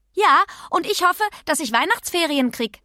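keckes Kind, höher und lauter als die Erzählung; kecke Pippi. Acted. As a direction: sprich als freche, selbstbewusste Pippi. (freche Pippi-Stimme)

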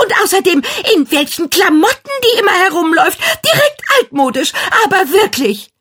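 jealous rant. A narrator's reading. Jealous girl rants at mirror.

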